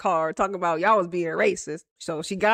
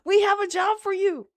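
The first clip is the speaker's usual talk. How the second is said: higher, eager job offer; mock-official higher-pitched welcome; America; 8 semitones higher than usual. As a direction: put on a pleased recruiter's voice. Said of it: "America was like".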